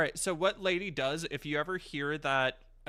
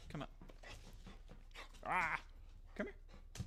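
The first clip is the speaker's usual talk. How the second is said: soft calling to dog; 3 semitones higher, 10 dB quieter than usual. Real, not acted.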